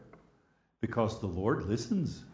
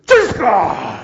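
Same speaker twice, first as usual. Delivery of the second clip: bursting out with the name, much louder; excited exclamation; 7 semitones higher, 14 dB louder than usual. Retold or imitated. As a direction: shout the name out in joyful recognition. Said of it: "Like that".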